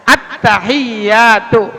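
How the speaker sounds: slow "Attahiyatu" with a held, doubled y; tasydid on ya. Shown as a demonstration. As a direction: lean on the "yya" in "Attahiyatu". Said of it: "ya-nya". ("Attahiyatu" dengan ya bertasydid)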